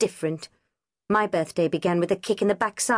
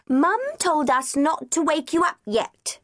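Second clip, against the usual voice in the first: a hint of a higher child's voice; lightly voiced small boy; 9 semitones higher than usual. A narrator's reading. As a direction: speak like a cheeky little boy. Small boy Davy speaking.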